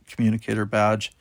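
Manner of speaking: plain reading voice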